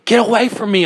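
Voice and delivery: high, loud panicked yell. A narrator's reading. Narrator to the staring eyes in the mirror, yelling. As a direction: yell to drive them off. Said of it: "I yelled".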